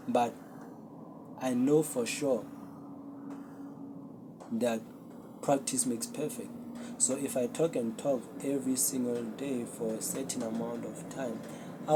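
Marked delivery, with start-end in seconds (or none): none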